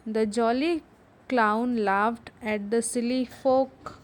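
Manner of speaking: slow, careful reading for the class; deliberate teacher read-aloud. Shown as a demonstration. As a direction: read it slowly so they can follow. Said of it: "उसको ज़ोर से पढ़ेंगे".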